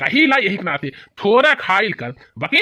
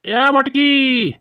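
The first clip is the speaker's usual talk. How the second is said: drawn-out call across the house, higher; 5 semitones higher, much slower than usual. Acted. Husband calling his wife from bed, drawn-out call. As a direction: call her name long and slow.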